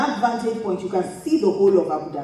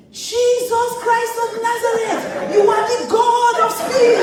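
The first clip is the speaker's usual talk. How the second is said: much higher, loud, slow mock scream on the ride; retold frantic shout; 12 semitones higher, 4 dB louder, slower than usual. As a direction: yell it like a terrified prayer at full speed. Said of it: "the guy in front of us shout".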